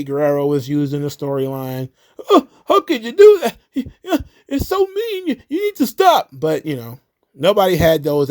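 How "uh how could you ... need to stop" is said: very high, fake-weepy whine; whiny mock crying fan. Mocking fans upset about Eddie Guerrero's storyline, whiny fake cry. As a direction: blubber it like an offended fan.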